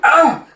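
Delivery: short, high, loud yelp; acted startled cry. Onlooker at the scuffle, acted startled cry. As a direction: cry out in mock alarm.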